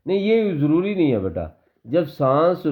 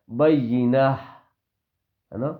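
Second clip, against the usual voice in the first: ordinary delivery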